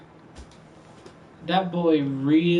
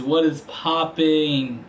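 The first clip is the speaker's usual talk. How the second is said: slow drawn-out greeting; 5 dB louder, slower than usual. Real, not acted.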